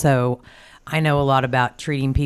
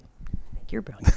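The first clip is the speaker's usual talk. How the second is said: whispered aside; 6 semitones higher, 7 dB quieter than usual. Real, not acted.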